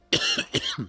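short dry cough. Real, not acted. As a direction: cough once, mid-thought. Cough interrupts the sentence.

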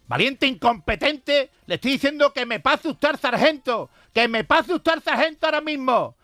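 high, loud, slow barking general; angry general. Retold or imitated. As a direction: order him about, furious and high. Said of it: "le contestó el general".

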